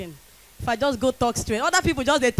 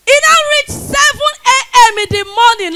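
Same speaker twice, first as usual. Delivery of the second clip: much higher and louder, outraged; indignant outburst; 10 semitones higher, 7 dB louder than usual. Acted. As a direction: blurt it out in disbelief. Indignant story climax.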